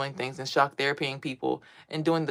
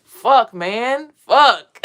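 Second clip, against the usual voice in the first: startled cursing; 5 semitones higher, 10 dB louder, much slower than usual. Retold or imitated. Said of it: "I just hear".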